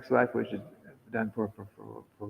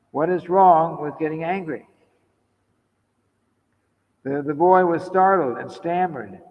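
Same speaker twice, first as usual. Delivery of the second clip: higher, louder declaimed reading; read-aloud letter; 6 semitones higher, 10 dB louder than usual. Acted. Speaker reading out a letter about getting angry, declaimed reading. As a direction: project the letter to the whole room.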